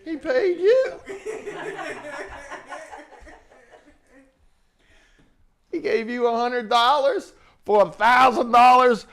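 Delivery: mocking realization. Speaker reveals the truth mockingly.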